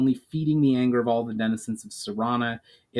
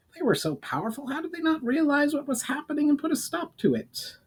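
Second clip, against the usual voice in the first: higher goblin, curious and probing; 4 dB quieter than usual. Acted. Goblin questioning the elf about the elementals, higher voice. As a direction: pitch up and question eagerly.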